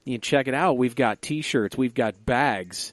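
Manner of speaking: a slow, upbeat merch ad read; sponsor plug. Acted. Hosts pitching their merch shop to listeners, upbeat ad read. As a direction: pitch the shop like a cheesy announcer.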